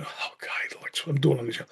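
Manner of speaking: fast hushed whisper of disbelief; whispered to himself. Retold or imitated. Actor whispering to himself after the boss's praise. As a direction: breathe it out to yourself, quick and hushed. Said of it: "I went".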